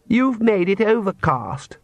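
high, slow, drawn-out sulky complaint; sullen. Acted. Daughter blaming father for the weather, sullen. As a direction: complain like a sulking daughter, dragging it out. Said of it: "said Lacrimosa sullenly".

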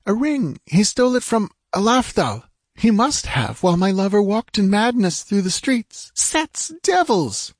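high-pitched, agitated female character voice; frantic woman. Acted. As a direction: explain in desperate haste, ending in a curse. Frantic woman searches and explains.

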